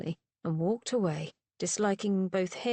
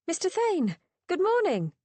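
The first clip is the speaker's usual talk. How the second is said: bright, much higher call; cheerful greeting; 11 semitones higher, 4 dB louder than usual. A narrator's reading. Woman greeting Thane across the crowd, cheerful call. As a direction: call out a friendly good morning. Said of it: "feminine, pleasantly husky voice".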